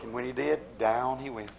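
low, hushed sinking delivery; quiet storyteller's drop; dramatic drop. Acted. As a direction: let the voice sink with him. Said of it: "Why do you say it like that".

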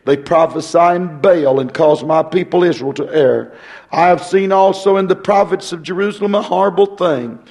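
ordinary talk, nothing marked

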